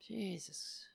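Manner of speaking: breathy exasperated whisper. Real, not acted. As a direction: sigh it under your breath. Exasperated aside under breath.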